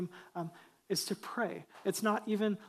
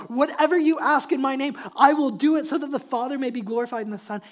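much louder, higher scripture quote; emphatic re-reading; 5 semitones higher, 11 dB louder than usual. Real, not acted. Preacher making sure they heard the verse, emphatic loud re-reading. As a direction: drive the verse home at full voice. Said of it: "Did you hear what he said?".

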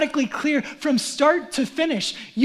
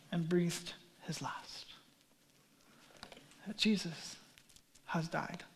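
much softer, breathy hush; hushed whisper; 8 semitones lower, 17 dB quieter, slower than usual. Real, not acted. Preacher telling the death of Jesus, hushed. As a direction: let the words fade to a whisper.

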